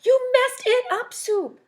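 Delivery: Milk yelling at Soup over her paper. Acted. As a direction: scream it like a spoiled kid. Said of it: "she yelled and stomped her feet".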